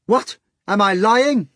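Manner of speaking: high, loud challenge; yelling. A narrator's reading. Natasha yells at the hog, high and loud. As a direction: yell it at the hog. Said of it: "Natasha yelled at the hog".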